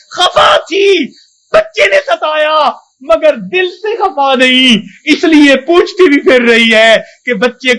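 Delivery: emotional preacher swelling toward a cry; fervent preaching. Acted. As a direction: preach it with rising passion, near tears. Preacher building to fervent climax.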